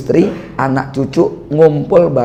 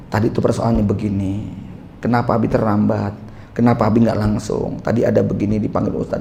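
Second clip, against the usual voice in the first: softer and lower, patient explanation to the wife; calm explaining; 5 semitones lower, 7 dB quieter than usual. Retold or imitated. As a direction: explain quietly after cooling down. Calm explanation once anger cooled.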